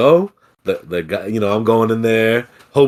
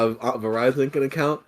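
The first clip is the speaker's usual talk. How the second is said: ordinary delivery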